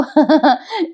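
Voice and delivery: short rising chuckle; amused laugh. Real, not acted. Host laughs at Raja's fall, real chuckle. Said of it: "राजाची कंबर चांगली शेकली".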